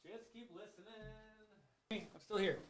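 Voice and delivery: distant, very quiet call from across the room; away from the microphone. Real, not acted. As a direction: call out from far off the mic. Stepped away to fix the echo.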